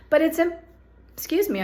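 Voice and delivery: interrupted by hiccups; hiccuping mid-sentence. Real, not acted. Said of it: "I've got the hiccups".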